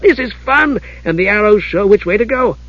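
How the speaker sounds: high, eager kender chatter; excited. A narrator's reading. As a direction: rattle off discoveries in a perky high voice. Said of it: "said Tass excitedly".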